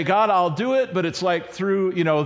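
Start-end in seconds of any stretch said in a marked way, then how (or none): 0.0-0.9 reluctant promise. Retold or imitated. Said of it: "and we say".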